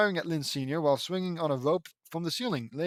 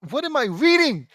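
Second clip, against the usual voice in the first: loud, high-pitched disbelief; exasperated bafflement; 11 semitones higher, 10 dB louder than usual. Real, not acted. Reader confused by the script, exasperated. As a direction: burst out, utterly baffled by the text.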